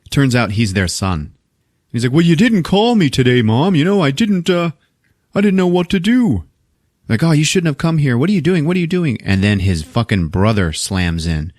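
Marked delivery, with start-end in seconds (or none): 2.1-6.4 high-pitched put-on voice, excusing himself; mock pleading son. Son whines to his mother. 7.2-9.2 high-pitched, flustered scolding; mock fretting mother. Mother frets at son's arrival.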